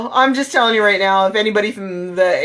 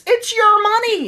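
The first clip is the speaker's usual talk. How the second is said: high, drawn-out exasperated protest; indignant; 12 semitones higher than usual. Real, not acted. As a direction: insist on it in outrage. Indignant rant about the slogan.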